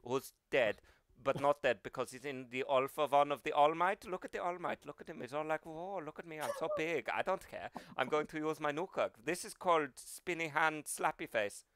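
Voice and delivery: light-voiced German villain; All for One. Villain boasting of stolen quirks, light German accent. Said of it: "light-voiced German".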